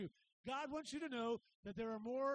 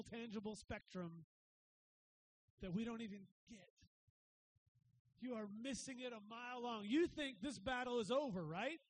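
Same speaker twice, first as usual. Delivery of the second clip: ordinary delivery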